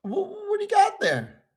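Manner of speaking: high, squeaky shocked question; surprised squeal. Acted. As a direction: shriek it in disbelief.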